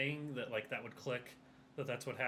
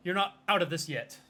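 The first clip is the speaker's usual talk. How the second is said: loud, raised, commanding character voice; stern interrogator character; 5 semitones higher, 6 dB louder than usual. Acted. Player's character warns the suspect holding a razor, stern and loud. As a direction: warn firmly, asserting control.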